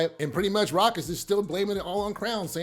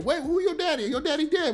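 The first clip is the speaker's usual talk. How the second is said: shocked disbelief; 8 semitones higher than usual. Retold or imitated. Howard shocked at Kanan's hint.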